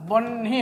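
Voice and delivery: "বহ্নি" split in two, long n then hi; n before h. Shown as a demonstration. Teacher showing how it sounds, বহ্নি with n ahead of h. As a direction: say বহ্নি as "bonn-hi", n held. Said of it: "আগে হয়ের উচ্চারণটা আসছে না".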